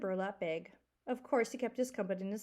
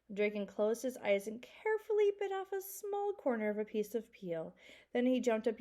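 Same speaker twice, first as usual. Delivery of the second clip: ordinary delivery, nothing marked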